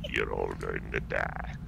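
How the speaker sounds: low, slow, menacing villain; deep villain voice. Acted. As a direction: growl it out like a dark overlord.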